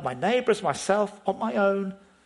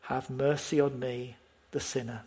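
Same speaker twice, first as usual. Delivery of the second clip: softer, fading plea for mercy; pleading quietly; 5 semitones lower, 5 dB quieter than usual. Shown as a demonstration. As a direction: beg for mercy under your breath. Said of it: "I plead".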